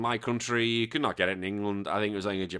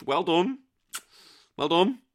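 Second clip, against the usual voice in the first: grudging, higher-pitched congratulations; through gritted teeth; 7 semitones higher, 3 dB louder, slower than usual. Acted. Host grudgingly congratulating a viewer through gritted teeth. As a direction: praise them while clearly jealous. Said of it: "I say this with gritted teeth".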